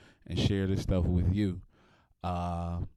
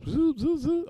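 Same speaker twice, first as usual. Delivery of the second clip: high zoop swipe noises; swipe sound effect; 3 dB louder, slower than usual. Retold or imitated. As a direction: mimic a card machine zipping. Imitating card swipe sound.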